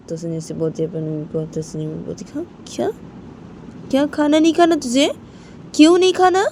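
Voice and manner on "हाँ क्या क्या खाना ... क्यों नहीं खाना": much higher, louder questioning; alarmed that he'll skip dinner; startled mother. Acted. Mother shocked asking. (चौंकी हुई माँ, बहुत ऊँची आवाज़)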